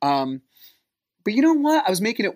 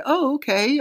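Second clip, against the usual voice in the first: fake-casual oh okay; 11 semitones higher than usual. Feigned casual reply to kids.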